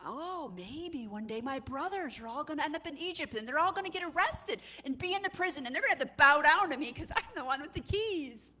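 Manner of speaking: high, swooping, swelling in volume; excited daydream. Acted. Joseph's imagined gloating daydream.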